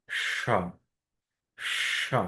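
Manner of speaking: সা with lots of air escaping; breathy s sound. Shown as a demonstration. Teacher shows the airy s for students. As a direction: push a long stream of air through সা. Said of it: "বাতাসটা অনেক বেশি বেরিয়ে যাবে".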